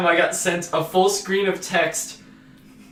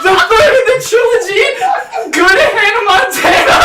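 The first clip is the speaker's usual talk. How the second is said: high, loud, laughing hard; real uncontrolled laughter; 8 dB louder than usual. Real, not acted. Podcaster revealing the last text to friends, cracking up. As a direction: push the punchline out through laughter.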